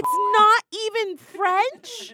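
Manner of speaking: high, loud disbelief, "even French" drawn out; indignant outburst. Real, not acted. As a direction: protest it as an outrage. Mock outrage at the name.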